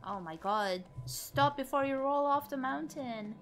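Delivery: normal talking voice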